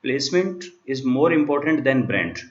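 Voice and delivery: unhurried teacher reading; slow read-out. Shown as a demonstration. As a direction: lay each word down for the class. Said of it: "preferred over या important over भी कर सकते हैं इसे".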